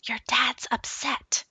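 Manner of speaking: soft unvoiced whisper. Mom whispers to her son to drop the dog topic, whispered. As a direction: hush the child quickly. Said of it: "Mom whispered to me".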